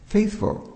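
"Faithful" leaps high with a falling tune; emphasis on faithful. Shown as a demonstration. Preacher stresses Paul's first word to the church. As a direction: lift "Faithful" high and let it fall. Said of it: "an emphasis in the first position".

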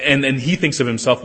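ordinary delivery

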